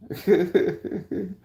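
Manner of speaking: high-pitched chuckling; amused giggle. Real, not acted. Laughing at own spiritual bit, amused giggle. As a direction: giggle at your own joke.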